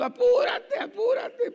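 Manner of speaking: panicked shout mid-laugh; laughing retold shout. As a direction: yell hurry up at your wife through laughter. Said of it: "Yo gritando".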